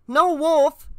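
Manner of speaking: put-on high, loud boy's protest; shouting back. A narrator's reading. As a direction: shout back in your own defence. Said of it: "Danny shouted back".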